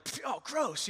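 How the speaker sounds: high, scoffing pfft and gross; mock child grossed out; kid disgust. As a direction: boys tasting flour, disgusted. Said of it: "they're like".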